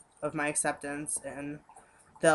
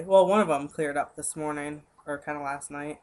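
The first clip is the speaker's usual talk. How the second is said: usual speaking voice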